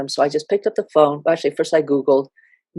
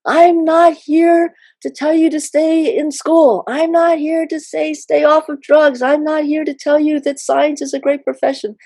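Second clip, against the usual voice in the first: retold yell; 11 semitones higher, 4 dB louder than usual. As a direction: shout each "not here" to cut through the noise.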